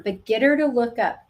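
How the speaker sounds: usual speaking voice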